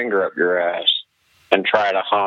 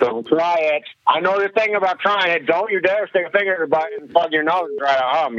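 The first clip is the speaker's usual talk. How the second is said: high warning voice; 6 semitones higher than usual. Acted. Caller warns in high voice.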